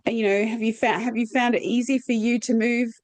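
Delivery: ordinary talk, nothing marked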